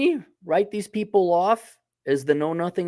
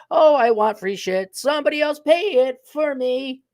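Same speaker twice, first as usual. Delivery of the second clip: mock whiny millennial; 11 semitones higher than usual. Mocking leftists in a whiny voice.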